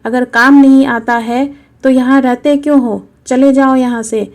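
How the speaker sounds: put-on loud scolding yell at the old man; furious mother-in-law yelling; angry shout. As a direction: shout him out of the house. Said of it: "Neha जोर से चिल्लाई".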